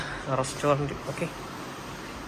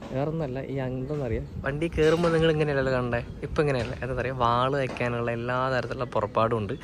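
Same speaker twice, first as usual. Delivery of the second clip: ordinary talk, nothing marked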